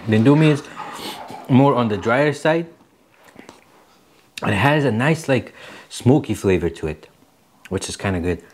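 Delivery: eating between words; chewing while talking. Real, not acted. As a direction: mouth partly full of noodles. Seen 0: Took a bite just before; lifts noodles, mouth busy while talking.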